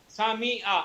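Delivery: सामी आ with a clear i in the middle; teacher modelling the correct form. Shown as a demonstration. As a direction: say सामी आ cleanly as the right answer. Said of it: "तीसरा जो सही है वो बोलता हूँ".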